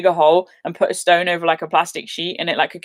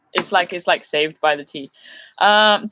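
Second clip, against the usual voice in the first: ordinary talk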